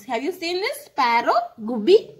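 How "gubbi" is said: "Gubbi" said the Kannada way, with a clear round u; Kannada word. Shown as a demonstration. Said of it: "In Kannada we say".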